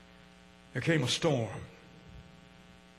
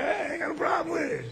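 mock high aside; 8 semitones higher, 8 dB quieter than usual. Preacher mocking reluctant hearer.

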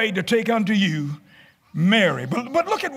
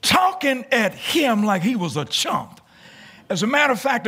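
ordinary talk, nothing marked

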